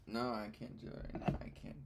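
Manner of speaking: ordinary talk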